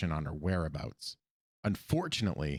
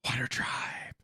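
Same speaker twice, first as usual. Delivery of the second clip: whispered Sokka; 4 semitones higher, slower than usual. Retold or imitated. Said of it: "he just goes".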